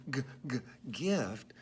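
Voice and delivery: "g, g" as a hard, short g before "gift"; isolated g sound. Shown as a demonstration. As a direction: clip "g" crisp and separate, then "gift". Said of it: "I hear a G sound says g".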